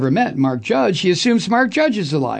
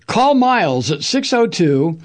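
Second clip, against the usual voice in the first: normal talking voice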